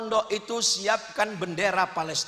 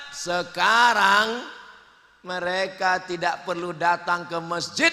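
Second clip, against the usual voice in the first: usual speaking voice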